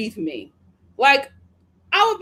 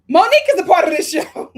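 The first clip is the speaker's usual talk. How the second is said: loud, raised-pitch exclamation; mock surprised realization; 5 semitones higher, 8 dB louder than usual. Host acting out her surprise at a cast member, loud exclamation. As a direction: burst out as if it just dawned on you.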